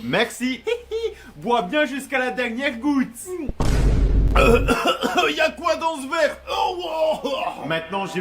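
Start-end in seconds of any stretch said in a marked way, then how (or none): 3.1-5.2 loud, high choking cough; hammed-up dying cough; poisoned coughing fit. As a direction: choke on the poisoned drink. Dad drinks the poison.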